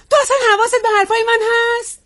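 high, fast, sharp demand; exasperated scolding. Acted. Wife to husband who is not listening, exasperated scolding.

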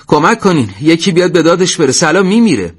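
high, urgent cry for help; shouting for help. A narrator's reading. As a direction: call out desperately for someone to save a friend. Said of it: "علی داد زد".

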